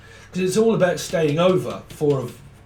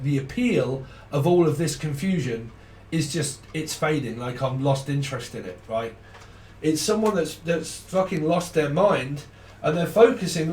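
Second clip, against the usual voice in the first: usual speaking voice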